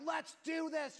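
high, pumped-up call; excited shout. Retold or imitated. Dad revving up for the final package, excited.

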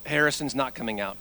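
ordinary delivery

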